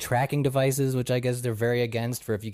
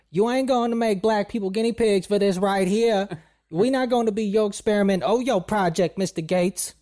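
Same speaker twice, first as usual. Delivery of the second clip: high-pitched, louder mock conservative host duo; indignant caricature of two political commentators, pitched way up; Diamond and Silk impression; 9 semitones higher, 4 dB louder than usual. Conservative hosts refusing Mister Gates's vaccine, high indignant impression. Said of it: "for those of you who think that's a racist accent, that's almost spot on".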